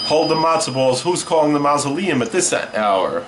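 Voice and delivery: ordinary talk, nothing marked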